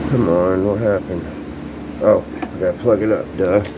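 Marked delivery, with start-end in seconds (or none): none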